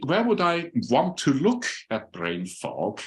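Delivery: normal talking voice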